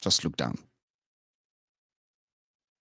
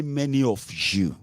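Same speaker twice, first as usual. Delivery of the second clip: ordinary talk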